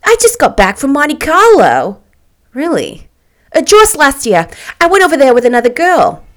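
put-on high, loud gushing young socialite; chatty, excited boasting; Catherine, excited. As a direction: show off the trip eagerly.

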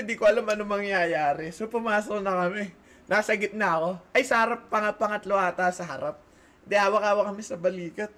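usual speaking voice

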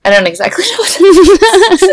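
high, laughing through the words; giggling while she says it. Real, not acted. Laughing through her answer.

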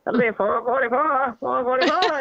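put-on harsh Boston accent, cashier repeating; thick Boston accent, repeating the price. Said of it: "in the harsh Boston accent".

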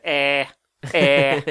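Irish comedian's drawn-out exclamation; Dara O'Brien. Acted. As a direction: open with a mock Irish "eh". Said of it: "you've done that impression".

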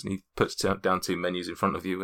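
ordinary talk, nothing marked

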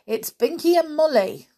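high, loud announcement; crying out. A narrator's reading. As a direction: call out who is there. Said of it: "cried Molly".

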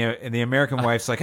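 ordinary delivery

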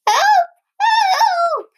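very high, loud elephant blare; trumpeted. A narrator's reading. Panicked Elephant calls out for help, high loud trumpeting. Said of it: "trumpeted Elephant".

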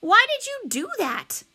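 high, loud cry of protest. A narrator's reading. Miles publicly blaming Josh for the spill, crying out. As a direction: wail it as an accusation. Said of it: "Miles cried out".

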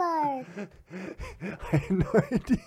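slow, high words through laughter; cracking up. Real, not acted. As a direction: laugh helplessly while talking. Cracking up at child's missing teeth.

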